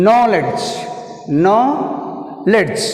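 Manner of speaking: Knowledge with long KNOW, falling -ledge; first-syllable stress. Shown as a demonstration. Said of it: "Stress is first syllable".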